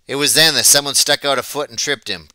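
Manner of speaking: usual speaking voice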